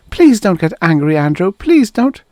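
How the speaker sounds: high, loud frightened begging; high, pleading wife. Acted. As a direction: beg him not to explode. Maya begs her angry husband.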